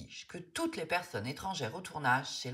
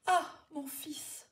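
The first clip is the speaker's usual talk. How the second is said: murmured; 13 semitones higher, slower than usual. A narrator's reading. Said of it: "murmure monsieur Lachance".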